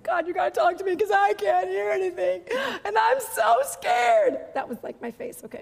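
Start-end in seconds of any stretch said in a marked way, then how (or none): 0.0-4.4 mock scared plea. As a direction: overplay the fear in prayer. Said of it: "where it's like".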